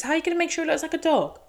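a teasing imitation of the judge; mock Paul question. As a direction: rattle it off in a silly quoting voice. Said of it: "the real questions are being asked by Paul".

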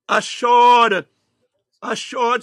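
loud emphatic assured; loud repeat. Shown as a demonstration. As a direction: hit assured hard on the second time. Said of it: "repeated for emphasis".

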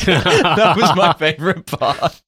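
high, slowed by real laughter; laughing. Real, not acted. Co-host delighted by the headbutt scene, really laughing. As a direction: laugh through the words.

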